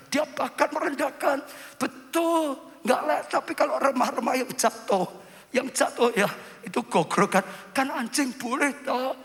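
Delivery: high-pitched voiced reply; humble woman pleading. Voicing the humble Canaanite woman's reply. (suara perempuan memohon, bernada tinggi)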